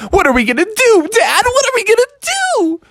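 put-on a frog kid begging dad for help; panicked kid.

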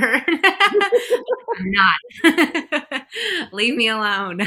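high, loud laughing brush-off; giggling at husband's joke; laughing protest. Retold or imitated. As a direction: laugh while telling him to leave you alone. Laughing retort to husband's teasing.

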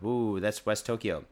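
ordinary delivery

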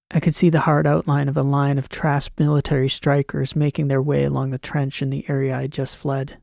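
ordinary delivery

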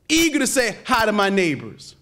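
ordinary talk, nothing marked

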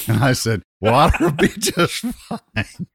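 cracking up while quoting himself; giggling meek reply; laughing. Real, not acted.

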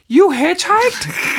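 high, slow, stunned question; shocked disbelief. Retold or imitated. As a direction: repeat it back in slow disbelief. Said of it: "And Stephanie's like".

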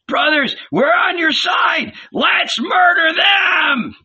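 high, wild mob shout; high-pitched battle cry. A narrator's reading. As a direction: yell it to rouse the fighters.

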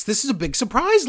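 high, mock-amazed sarcasm; sarcastic. Real, not acted. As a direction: pretend to be shocked. Mocking the obvious mystery.

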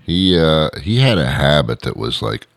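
usual speaking voice

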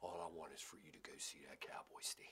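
soft, low whisper; whispered dad. Retold or imitated. Dad telling his son what gift he wants, whispered. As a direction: breathe it like a wistful dad.

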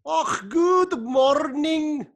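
a badly faked Scottish accent; mock Scottish accent. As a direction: ham up a Scottish accent, almost wailing. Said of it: "do it with a Scottish accent".

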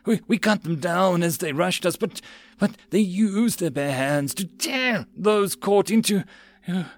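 high, slow, halting horror; stammering, trailing off in dread; voice full of dread. A narrator's reading. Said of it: "in a voice full of dread".

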